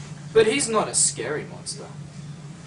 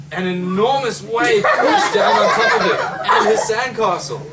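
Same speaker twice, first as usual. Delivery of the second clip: laughing; 3 semitones higher, 6 dB louder than usual. Real, not acted. Wave crashes onto the monster.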